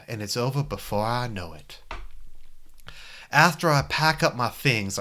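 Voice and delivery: put-on old-school Virginia gentleman; hammy Southern accent from a Civil War movie. Narrating his thoughts about a worried friend, Virginia accent. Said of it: "old school Virginia".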